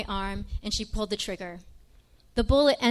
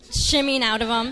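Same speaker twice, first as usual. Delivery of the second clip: ordinary talk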